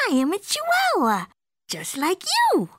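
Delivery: high, stretched cartoon voice; insistent kitty boy. A narrator's reading. Skipito claiming to be a Chihuahua, insistent. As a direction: declare it high, drawing out the ends.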